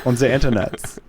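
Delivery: mock-stern German host; drawn-out, hammed-up German accent. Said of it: "angry Germans".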